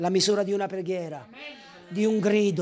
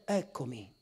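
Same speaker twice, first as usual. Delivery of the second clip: much softer, low, gentle reply; God answering; 11 semitones lower, 12 dB quieter than usual. Retold or imitated. God answering the preacher's cry, gentle.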